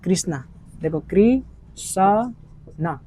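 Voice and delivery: कृष्णा drawn out slowly, syllable by syllable; slow spelled-out Krishna. Shown as a demonstration. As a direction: sound out कृष्णा slowly for the class. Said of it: "कृष्णा कैसे लिखते हैं हम लोग". (कृष्णा को धीरे-धीरे खींचकर बोलना)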